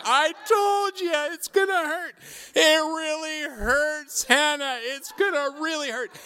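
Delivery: put-on high, loud child voice, wailing in distress; a frightened little girl pleading through her fear; panicked child. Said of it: "Hannah went round and round in circles going".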